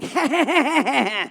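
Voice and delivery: high, choppy cackle; grating staccato laugh. Acted. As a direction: laugh in short grating bursts. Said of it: "short staccato laughs that were extremely grating".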